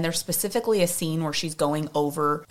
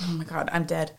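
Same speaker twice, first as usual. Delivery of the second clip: ordinary talk, nothing marked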